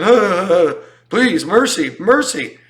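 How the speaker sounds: high, loud pleading for mercy; mock begging. Traumatized partner begging, hammed-up plea. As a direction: beg from the floor, hammed up. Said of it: "on the floor just going".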